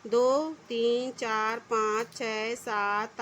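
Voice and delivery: put-on counting aloud very slowly for children; teacher counting lines slowly so kids follow. Shown as a demonstration. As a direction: draw each number out one by one. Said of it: "तो गिनेंगे एक".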